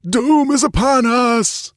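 slow, high-pitched wail of doom; screaming. A narrator's reading. Dying priest warns the queen of defeat, screaming. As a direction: scream it high, stretching the words. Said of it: "screamed the skull-like apparition".

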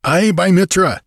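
high, loud oath; eager assent. A narrator's reading. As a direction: swear hearty support to the leader. Pirate eagerly swears support to Conan.

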